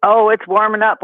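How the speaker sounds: ordinary talk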